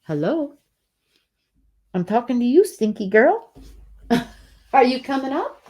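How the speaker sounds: high playful pet voice; kitty cat voice. Real, not acted. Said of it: "the kitty cat voice".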